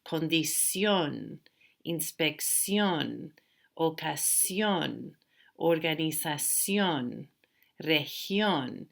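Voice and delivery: "Condición", "inspección": slow, long stressed endings; careful model, "región" stressed on "gión"; stress on last syllable. Shown as a demonstration. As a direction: lean on the final syllable, as in "ocasión". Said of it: "These are rule breakers".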